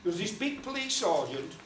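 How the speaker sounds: plain reading voice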